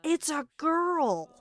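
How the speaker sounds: high, loud, slow astonished cry; surprised crowd shout. Retold or imitated. Crowd discovering the knight is a woman, shouting in surprise. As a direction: let the crowd's shock burst out. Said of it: "all you would hear was".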